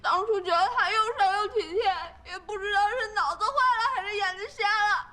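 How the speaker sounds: high tearful wail; crying. Acted. Heartbroken woman lamenting her bad choice of man to friends, crying. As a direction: lament it through sobs. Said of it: "别哭了啊".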